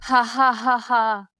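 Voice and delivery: scornful, spaced-out ha-ha-ha; mocking laugh. Mother mocking the woman who asks if affection was fake, scornful laugh. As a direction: laugh off the plea with contempt.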